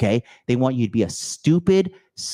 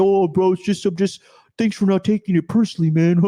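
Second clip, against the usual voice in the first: higher, louder fake-friendly voice with laughter; mock chummy bro; 6 semitones higher, 3 dB louder than usual. Mocking men fawning face to face.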